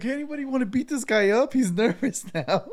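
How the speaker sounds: high, loud call to the crowd; half-laughing mock plea for help. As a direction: shout out to the group for backup, laughing at the end. Guide calling crowd for help.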